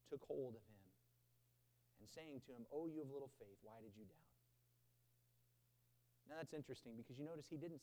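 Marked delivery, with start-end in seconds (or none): 2.7-4.3 much softer and lower, tender; soft, tender Jesus reaching out to Peter; gentle rebuke. Acted. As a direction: say it like a father lifting a child. Quoting Jesus' gentle reply.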